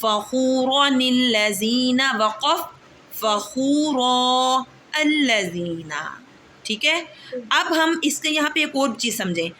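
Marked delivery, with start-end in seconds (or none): 0.0-2.7 फ़ख़ूरनिल लज़ीना drawn out as one joined phrase; slow recitation. Shown as a demonstration. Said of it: "अगली मिसाल". 3.1-6.0 फ़ख़ूरा with a long final a, then a pause, then अल्लज़ीना; pausing recitation. Shown as a demonstration. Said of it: "अगली मिसाल".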